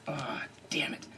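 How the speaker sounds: breathy under-the-breath curse; whispered curse. Retold or imitated. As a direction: hiss it to yourself, defeated. Muttering to himself on seeing rivals.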